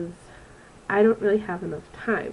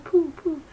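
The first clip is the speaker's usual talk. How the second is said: higher, louder little puffs of air; puffing pooh; 9 semitones higher, 3 dB louder than usual. Retold or imitated. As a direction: blow them out like puffs at a bug. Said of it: "by going pooh".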